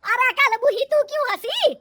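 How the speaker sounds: loud, high, outraged mother-in-law; shrill-tempered scolding shout; angry scolding. Acted. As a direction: yell it as a furious accusation. Mother-in-law scolds laughing daughter-in-law.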